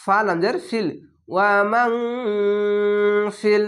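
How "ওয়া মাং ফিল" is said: "ওয়া মাং ফিল" with ং held as a long hum; chanted "ওয়া মাং ফিল", nasal stretched out; ikhfa ghunna. Shown as a demonstration. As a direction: hum through মাং before releasing ফিল. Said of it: "ইকফা গুন্না হচ্ছে".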